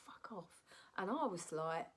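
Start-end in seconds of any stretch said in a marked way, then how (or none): 0.0-0.7 whispered under the breath; muttered swear. Retold or imitated. Judge mutters at contestant, whispered. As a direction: hiss it out barely voiced. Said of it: "I heard him go".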